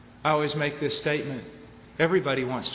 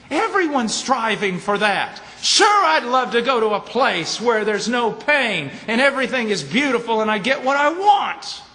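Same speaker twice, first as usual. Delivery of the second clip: preaching; 6 semitones higher, 6 dB louder than usual. Acted. Preacher to congregation, building pulpit fervour. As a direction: drive it home to the congregation.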